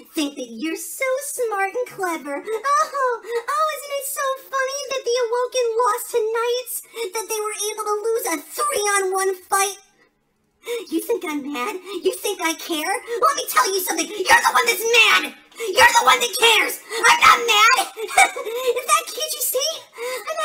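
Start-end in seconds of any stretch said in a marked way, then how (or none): 2.4-9.8 high-pitched, whiny sarcasm; mock the gloaters in a high wail; bitter mock amusement; mocking whine. Mocking those who laughed at loss. 12.9-17.8 high, loud angry shouting; losing his temper while denying it; furious denial. Acted. Insists he is not mad.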